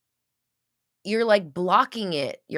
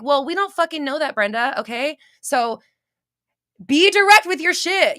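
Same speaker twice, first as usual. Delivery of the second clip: high and loud, fed-up rant; shrieking, rising frustration at a vague asker; exasperated scolding; 7 semitones higher, 7 dB louder than usual. Retold or imitated. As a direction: scold Brenda like the exasperated universe. Universe scolding Brenda.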